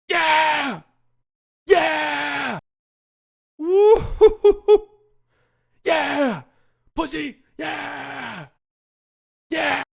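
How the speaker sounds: high, drawn-out whoops and yeahs; excited celebratory yelling. Acted. Celebrating Luka's step back three, exultant yelling. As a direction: yell in triumph, high and long.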